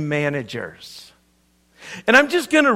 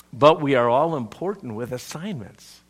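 ordinary delivery

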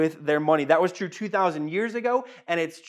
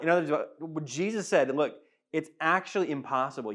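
normal talking voice

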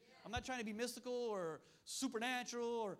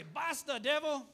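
"Basta" then "devil" shouted higher and much louder; shouted Dutch rebuke; 5 semitones higher, 11 dB louder, much slower than usual. Shown as a demonstration. Preacher repeating the Dutch rebuke to his church, shouting. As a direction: bellow "Basta, devil" at the enemy with full force. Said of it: "I like how they say it in Dutch".

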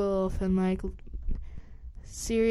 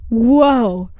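loud whoa; 2 semitones higher, 10 dB louder than usual. Retold or imitated. Kids react to Sirius appearing.

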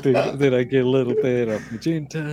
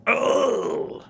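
higher, drawn-out groan of dismay; pained groan; 9 semitones higher than usual. Real, not acted. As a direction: groan at taking a heavy blow. Player takes a heavy hit.

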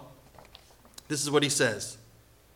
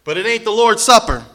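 higher, louder scolding Paul; strong rebuke; 6 semitones higher, 7 dB louder than usual. Retold or imitated. Preacher voices Paul scolding the church, loud and stern. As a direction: give it to them hard, scolding. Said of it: "Apostle Paul has to say".

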